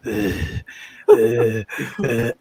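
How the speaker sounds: low strained groans into the mic; fake heart attack groans. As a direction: groan as if clutching your chest. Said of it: "I decide to fake a heart attack".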